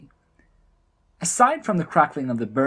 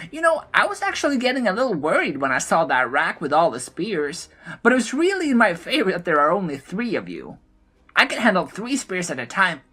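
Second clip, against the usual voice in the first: much higher, impish gnome voice; a mischievous little gnome teasing from above; gleeful gnome; 7 semitones higher than usual. A narrator's reading. The gnome taunts the blood trolls, high and impish. Gnome mocking spear throwers.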